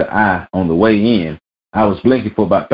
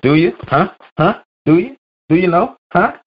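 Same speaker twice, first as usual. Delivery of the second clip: climbing higher and higher each time; rising hype call, ever higher; teasing build-up; 11 semitones higher than usual. Pretend. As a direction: keep pushing the pitch up, teasing. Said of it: "It's Friday rant time".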